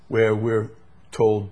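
normal talking voice

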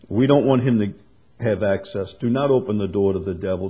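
usual speaking voice

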